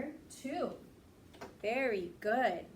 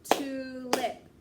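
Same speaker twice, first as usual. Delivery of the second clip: Tulip punched loud in two beats, TU-lip; clapped syllables; 6 dB louder than usual. Shown as a demonstration. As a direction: beat out Tulip with claps. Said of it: "Let's clap it together".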